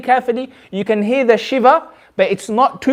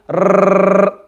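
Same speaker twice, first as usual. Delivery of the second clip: long trilled rrrr; rolled r. Shown as a demonstration. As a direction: let the tongue shiver, rrrr. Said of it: "that's what you call the shiver".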